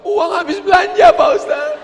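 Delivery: high, loud comic wail; hammed-up weeping complaint; mock crying woman. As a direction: wail it as a tearful housewife. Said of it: "Ibu-ibu di sebelahnya nangis juga".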